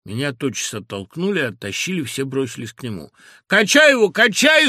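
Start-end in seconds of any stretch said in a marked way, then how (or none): 3.5-4.7 loud, much higher clamor; clamoring voices. A narrator's reading. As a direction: shout it over the crowd. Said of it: "зашумели голоса".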